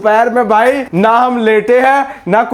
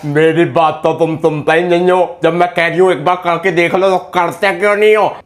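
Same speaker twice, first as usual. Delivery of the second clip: low-pitched mimicry of her nagging; put-on low complaining voice; mocking quote; 7 semitones lower than usual. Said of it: "कहती है, कहती है".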